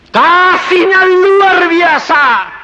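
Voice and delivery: slow and loud, each word weighed; slow, loud preaching cry; fervent proclamation. Acted. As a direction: proclaim it slowly with full force. Preacher's climax on God's love.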